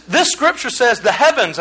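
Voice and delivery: normal talking voice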